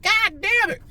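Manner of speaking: high-pitched mock-exasperated cry; exasperated outburst. Smoker retelling a cigarette lit backwards, high exasperated cry. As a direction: re-enact an exasperated yelp.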